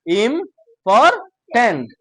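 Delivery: ইম্পর্টেন্ট chopped into ইম, পর, টেন্ট with gaps; slow three-beat ইম্পর্টেন্ট; separate syllables. Shown as a demonstration. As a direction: pause after each syllable of ইম্পর্টেন্ট. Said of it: "আলাদা আলাদা করে উচ্চারণ করবা".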